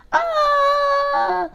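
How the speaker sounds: high frightened scream in a put-on voice; scared scream. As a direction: shriek like a ghost just appeared. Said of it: "everyone cried".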